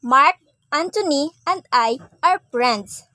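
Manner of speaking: "Mark, Anthony, and I", three separate people; list pauses. Shown as a demonstration. Teacher repeating for three friends, "Mark", "Anthony", "I" set apart. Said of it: "we are three".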